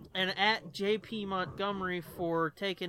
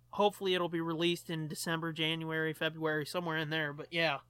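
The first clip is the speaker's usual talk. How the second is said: ordinary talk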